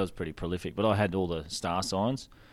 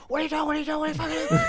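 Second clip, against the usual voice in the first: put-on yelling at kid; faster than usual. Shopkeeper charging out at the kid at the machine, shouting high. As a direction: burst out yelling at the thief.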